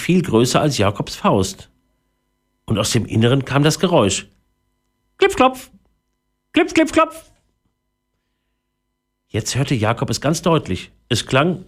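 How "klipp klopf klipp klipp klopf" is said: high, crisp knocking syllables; very high-pitched hammer noise; imitated tiny hammering. A narrator's reading. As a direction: tap out each word high, like a little hammer. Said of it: "wie ein Hämmern".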